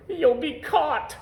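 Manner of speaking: high, slow, alarmed warning; frightened gasp. A narrator's reading. Lord Droon warning himself in panic, high and slow. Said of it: "gasped Lord Droon".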